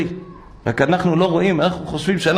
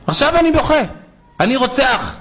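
put-on high, eager little boy; child's plea; 8 semitones higher than usual. Little boy telling his father he will pray for a brother, high eager child voice. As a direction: voice a small boy begging.

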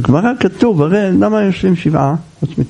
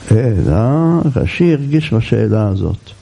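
usual speaking voice

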